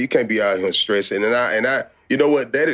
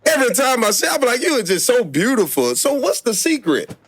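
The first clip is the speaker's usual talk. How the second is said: excited gushing; 11 semitones higher than usual. Real, not acted. Gushing compliments to guest.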